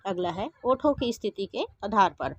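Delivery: normal talking voice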